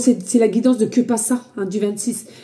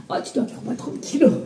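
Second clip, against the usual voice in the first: ordinary talk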